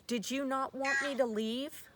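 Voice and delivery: ordinary talk, nothing marked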